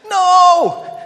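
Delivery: loud high protesting shout of no; Jonah's shout. Retold or imitated. Jonah shouting his refusal at God. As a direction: yell it like a furious prophet refusing. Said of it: "what is Jonah's reaction".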